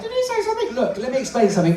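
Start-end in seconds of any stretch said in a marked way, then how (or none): 0.0-0.7 high squeaky puppet voice; little dummy voice, pitched way up; Minnie Paul. Acted.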